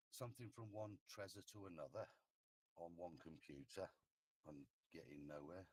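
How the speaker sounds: faint, far from the mic; barely audible. Real, not acted. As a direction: voice barely coming through. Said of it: "your voice is very quiet".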